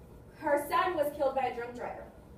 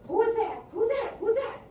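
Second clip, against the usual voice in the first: high, loud, jumpy questions; startled; 9 semitones higher, 7 dB louder than usual. Acted. Drunk to an unseen caller on the road, startled. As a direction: call out in alarm into the dark.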